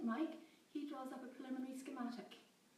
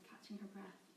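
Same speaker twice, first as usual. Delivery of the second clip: plain reading voice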